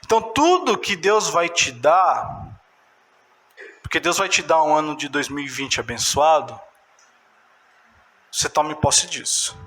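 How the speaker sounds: usual speaking voice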